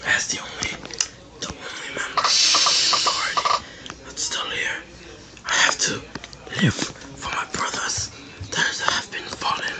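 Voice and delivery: unvoiced solemn whisper. Real, not acted. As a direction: whisper it like a fallen soldier's vow. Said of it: "sorry if I'm being quiet".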